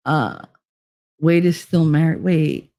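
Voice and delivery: normal talking voice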